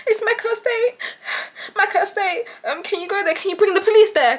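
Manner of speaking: high, jittery pleading; fake scared. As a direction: keep selling the scared act. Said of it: "'Cause I'm sounding, like, so scared".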